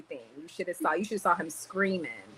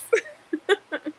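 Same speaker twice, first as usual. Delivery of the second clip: high-pitched burst of giggles; amused laugh; 12 semitones higher, 4 dB louder than usual. Real, not acted. Thanking a flattering viewer, amused laugh.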